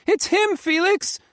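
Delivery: high, loud frantic shout. Acted. Faye shouts at Felix that it's her grandpa, loud and high. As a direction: yell it at him. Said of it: "she shouted".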